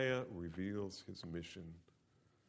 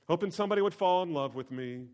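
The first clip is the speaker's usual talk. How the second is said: hoping somebody — said louder and higher; louder, higher emphatic repeat; 6 semitones higher, 6 dB louder than usual. Acted. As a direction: build the phrase up and push it out.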